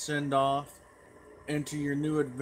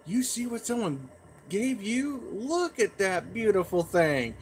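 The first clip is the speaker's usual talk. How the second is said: higher put-on voice of a delighted mother; mock-mom gushing, pitched up; admiring mom; 5 semitones higher than usual. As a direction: imitate Mom marvelling over a gift. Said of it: "and she's like".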